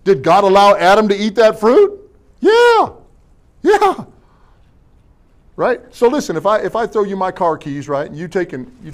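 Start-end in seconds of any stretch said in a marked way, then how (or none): 2.4-4.0 high, drawn-out mock yeah; a playful high yes, yes. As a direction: sing out a teasing yeah.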